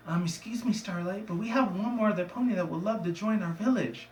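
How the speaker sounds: light character voice, politely asking; lightly voiced pony. Voices pony addressing village leader.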